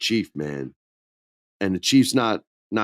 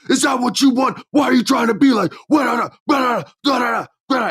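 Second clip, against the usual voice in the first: high, louder, fast yelling rant; mock ranting shout; 13 semitones higher, 4 dB louder than usual. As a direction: tear into him in a hammed-up shout. Roman ranting at Sami.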